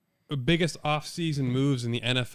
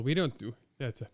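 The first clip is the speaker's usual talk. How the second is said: usual speaking voice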